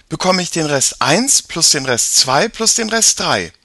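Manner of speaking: put-on a clever girl explaining; girl character voice. Mia explains the remainder rule.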